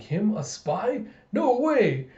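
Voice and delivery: high, emphatic incredulity; slow scoffing question, then a loud high denial; mock disbelief. Spy imagines people dismissing suspicion, incredulous. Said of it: "that makes people think".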